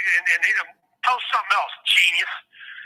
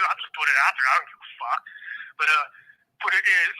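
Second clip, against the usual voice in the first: usual speaking voice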